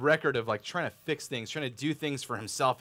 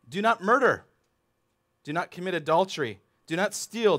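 louder, higher commandments; stern commands; 5 semitones higher, 6 dB louder than usual. Acted. Jesus listing commandments to the young man, firm and loud. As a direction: proclaim each command. Preacher quoting commandments forcefully.

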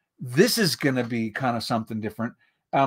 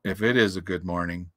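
low gloomy donkey voice; gloomy Eeyore; 7 semitones lower, 4 dB quieter than usual. A narrator's reading. Donkey doubting it is a good morning, gloomy. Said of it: "said Eeyore gloomily".

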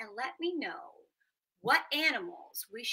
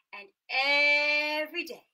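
ordinary delivery

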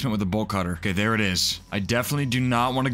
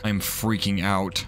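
ordinary talk

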